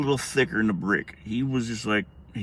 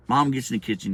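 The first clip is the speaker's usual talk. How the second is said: ordinary delivery, nothing marked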